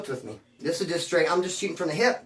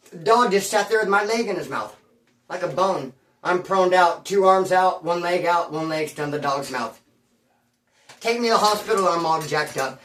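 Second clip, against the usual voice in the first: usual speaking voice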